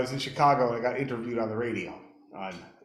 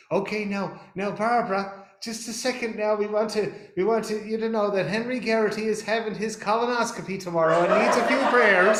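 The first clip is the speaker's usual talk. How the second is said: put-on higher, louder old Irish lady; old Irish sidekick; 9 semitones higher, 6 dB louder than usual. Old sidekick interrupts radio host with a prayer request, high and insistent. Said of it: "the old one says".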